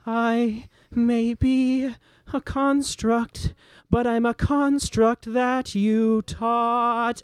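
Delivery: high, very slow, measured rage; furious defiance. Acted. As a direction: draw out each word high, in defiance of a father. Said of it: "looks up at her father with furious rage".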